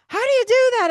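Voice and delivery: high, loud astonished "How do you do that?"; amazed friends. Retold or imitated. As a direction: friends gasping at the trick. Friends amazed by her psychic sense.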